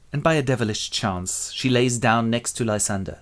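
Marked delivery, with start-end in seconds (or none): none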